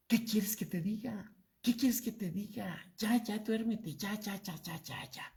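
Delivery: grumbling irritated retort, fading to a mutter; huffy husband waving her off, trailing away; annoyed brush-off. Retold or imitated. Husband brushing off his wife in bed, grumpy and fading. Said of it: "Estaba muy enojado".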